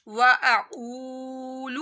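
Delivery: slow tajweed reading of و اقول; heavy qaf, long waw. Shown as a demonstration. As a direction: stretch the oo of اقول and give قاف a thick throat sound. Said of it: "قاف موٹا پڑا جائے گا".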